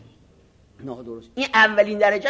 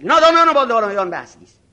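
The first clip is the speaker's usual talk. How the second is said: louder, stress on نادانان, falling; haughty shout; 3 semitones higher, 7 dB louder, faster than usual. Retold or imitated. Arrogant cleric repeating his verdict to a student, loud and scornful.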